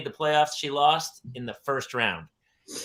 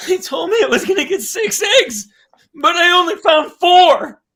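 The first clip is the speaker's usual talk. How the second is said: put-on high, loud wailing kid voice; hammed-up high sobbing complaint; imitating sobbing child; 12 dB louder than usual. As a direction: wail it like a heartbroken little girl. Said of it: "beside herself and sobbed".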